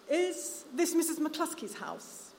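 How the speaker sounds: put-on high, slow voice of a stout ten-year-old; a child asking politely; child's voice. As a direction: ask like a polite small boy at the gate. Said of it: "a stout blonde child of about ten".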